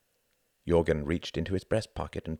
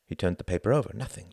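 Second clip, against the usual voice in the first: ordinary delivery, nothing marked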